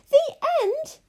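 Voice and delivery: very high, loud, indignant echo. A narrator's reading. Abilene objects to the story's ending, indignant. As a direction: burst out in outraged disbelief. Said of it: "said Abilene indignantly".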